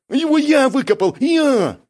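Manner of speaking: very high, loud protest; indignant. A narrator's reading. Бяка insisting he dug it himself, indignant. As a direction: insist shrilly on the credit. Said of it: "тоже возмутился Бяка".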